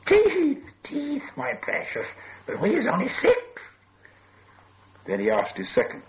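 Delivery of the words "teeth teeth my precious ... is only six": very high, drawn-out creature voice with breathy hisses; a thin, high cave-creature whine; hissing creature. A narrator's reading. Gollum answering with his precious, high hissing whine. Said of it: "he hissed".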